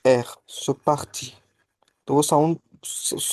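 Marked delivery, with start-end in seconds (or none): 0.1-0.3 throaty एख like an Arabic sound; guttural French r. Shown as a demonstration. Tutor demonstrating the French R sound to a student, throaty. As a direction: scrape एख at the back of the throat. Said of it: "Arabic sound होती है".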